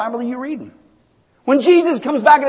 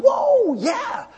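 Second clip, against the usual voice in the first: mock shout of joy; 10 semitones higher, 5 dB quieter than usual. Shown as a demonstration. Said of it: "we should be shouting".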